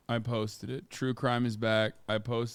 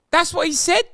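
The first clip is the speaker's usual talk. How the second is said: high, loud mock Kiwi voice; Kiwi caricature; 8 dB louder than usual. Streamer mocking a Kiwi chatter, in a Kiwi accent. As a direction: keep the Kiwi bit going.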